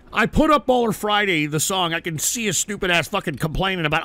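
ordinary talk, nothing marked

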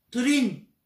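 quick loud call of the spell; urgent call. Acted. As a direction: shout the spell to save himself. Spell called in danger. (haastig en luid roepen)

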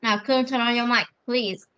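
usual speaking voice

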